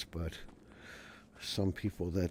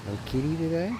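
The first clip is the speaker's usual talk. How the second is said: calling the cat; 10 semitones higher, 7 dB louder than usual. Real, not acted.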